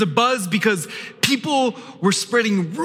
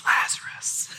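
whisper; 6 dB quieter than usual. Acted. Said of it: "whispered name".

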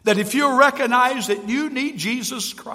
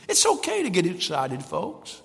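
ordinary talk

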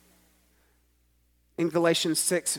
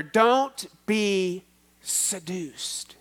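slow drawn-out warning; much slower than usual. Acted. Paul quoted, slowed.

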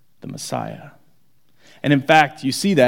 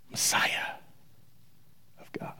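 whisper; 16 dB quieter than usual. Retold or imitated. Peter's confession, whispered close.

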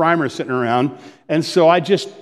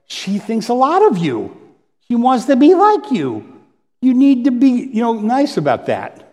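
gentle coaxing; 7 semitones higher than usual. Retold or imitated. Mother gently reassuring her irritated daughter, higher and coaxing. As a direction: coax her kindly to be nice.

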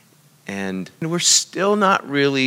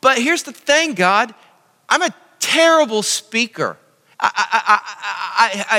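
much higher, louder, stuttering with a laugh; stammering Moses; 11 semitones higher, 5 dB louder than usual. Retold or imitated. Preacher as Moses begging God to pick someone else, high stammering. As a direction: stammer and trip over every I. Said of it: "four times Moses protests".

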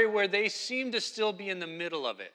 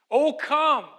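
higher, louder invitation to come; rousing call; 6 semitones higher, 6 dB louder than usual. Acted. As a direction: call the people in like the psalmist. Preacher voices psalmist's invitation.

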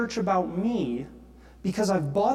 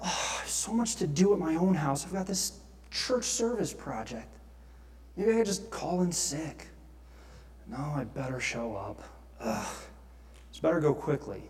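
softer, reluctant inner voice, dragging his feet; grudging inner grumble; 6 semitones lower, 7 dB quieter than usual. Retold or imitated. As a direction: mutter the excuses half to yourself. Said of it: "I've woken up on a Saturday and I thought".

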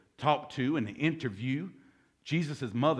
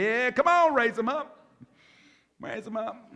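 much higher and louder, egging the crowd on; excited urging; 10 semitones higher, 4 dB louder than usual. Real, not acted. Preacher urging the congregation to confess, loud and excited. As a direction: hype them up to raise their hands.

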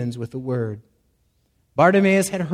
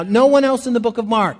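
ordinary talk

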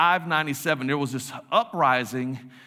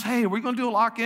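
higher, quicker voice of a keen student; eager student; 7 semitones higher, faster than usual. Retold or imitated. Students pitch a lock-in to youth pastor, eager mimicry. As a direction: imitate an excited kid pitching an idea.

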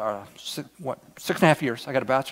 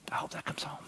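hushed, voiceless whisper; 13 dB quieter, faster than usual. Real, not acted. Pastor to congregation, hoping the story lands, whispered. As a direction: breathe it out like a secret hope. Preacher closing his message.

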